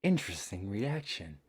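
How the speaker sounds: breathy, exaggerated anime voice; over-the-top anime girl echo. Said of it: "Again with the anime voice".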